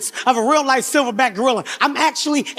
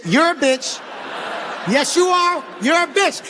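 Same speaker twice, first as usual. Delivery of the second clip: mock insult; 3 semitones higher, slower than usual. Insulting the guide.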